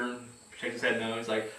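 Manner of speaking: ordinary delivery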